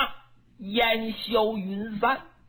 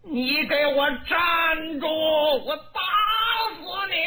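furious shout; 7 semitones higher than usual. Acted. Dong Zhuo yells at the running Lü Bu, furious. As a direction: roar at him to stop.

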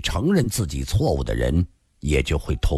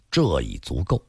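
usual speaking voice

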